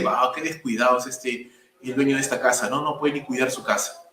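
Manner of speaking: disapproving remark. Pretend. Preacher voicing a passerby judging a dirty house, low and disapproving. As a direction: murmur disapproval of the neglected house.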